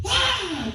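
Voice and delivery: very high-pitched comic scream; hammed-up frightened shriek; mock yell. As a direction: let out a sudden high "ah". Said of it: "Somebody yelled".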